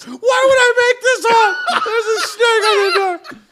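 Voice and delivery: high, loud, laughing exasperation; a kid pleading to be believed; exasperated alarm. Retold or imitated. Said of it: "He's like".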